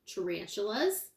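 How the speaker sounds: usual speaking voice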